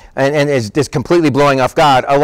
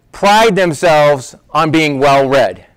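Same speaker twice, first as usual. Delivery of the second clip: ordinary talk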